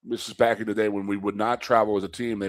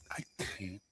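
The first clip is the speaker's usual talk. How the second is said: much softer, deflated voice; defeated mutter; 14 dB quieter than usual. Retold or imitated. Wrestler doubting himself in the room, very quiet and defeated. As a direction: sink into self-doubt. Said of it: "you got a kid sitting there saying".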